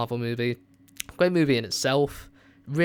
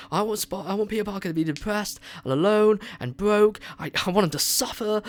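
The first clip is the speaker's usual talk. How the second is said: mocking fans; 7 semitones higher than usual. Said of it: "what people want".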